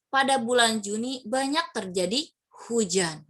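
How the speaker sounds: slow, with "hujan" pressed; single out "hujan", not a landslide; stress on hujan. Shown as a demonstration. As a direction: land firmly on "hujan". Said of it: "ada tekanan khusus".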